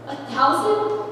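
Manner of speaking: plain reading voice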